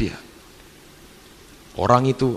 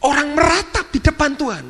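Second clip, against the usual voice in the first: high, slow, breathy outraged hiss rising to harsh cries; harsh rebuke; 12 semitones higher than usual. Retold or imitated. Visiting preacher rebuking the church for stopping the weeping, harsh outrage. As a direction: start in a hissed whisper, then let the outrage break out. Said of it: "Ngomongnya kasar".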